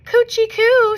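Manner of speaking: put-on high, cooing baby-talk voice; mock sweet coo to a supposed baby doll. A narrator's reading. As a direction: coo it like teasing a toddler.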